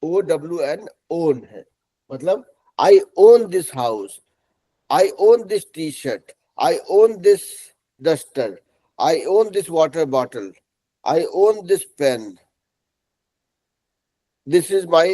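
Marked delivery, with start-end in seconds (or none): 2.1-12.3 I own this, stretched out for learners; teacher spelling out each word slowly; slow example sentences. Shown as a demonstration. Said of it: "teacher example sentences".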